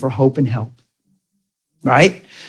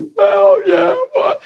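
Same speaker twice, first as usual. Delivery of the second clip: much higher, wailing yeah what; a high, tearful cry of a caller; mock crying outburst; 14 semitones higher, much slower than usual. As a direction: blurt it out through imitated tears.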